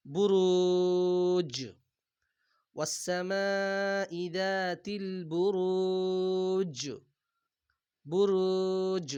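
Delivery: slow, drawn-out tajweed chant; Quran recitation. Acted. Teacher recites the verse slowly for students. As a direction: stretch each vowel like a reciter.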